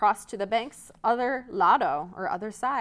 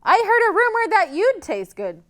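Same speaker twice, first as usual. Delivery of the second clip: high, loud hungry troll; taunting troll; 11 semitones higher, 8 dB louder than usual. Acted. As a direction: tease the goat greedily. Troll taunts middle goat.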